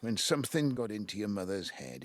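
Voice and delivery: normal talking voice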